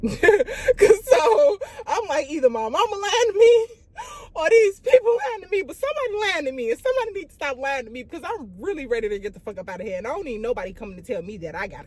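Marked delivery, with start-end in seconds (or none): 0.0-7.3 high, loud, half laughing half sobbing; laugh-crying. Real, not acted. Unsure who is lying to her, laughing through tears. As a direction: let the panic crack into tearful laughter. Panicking, thinking someone's lying.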